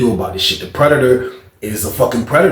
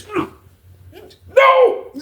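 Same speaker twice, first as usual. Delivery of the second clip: muffled hum then high no; imitated cry of a victim. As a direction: imitate a muffled scream from next door. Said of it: "that shit started sounding like this".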